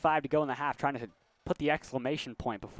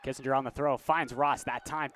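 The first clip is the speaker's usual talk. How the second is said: ordinary talk, nothing marked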